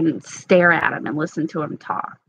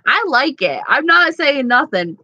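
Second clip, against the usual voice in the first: Southern twang; 6 semitones higher than usual. Real, not acted. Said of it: "it's making me have, like, this twang".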